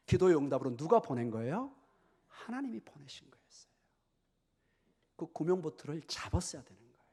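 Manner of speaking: ordinary talk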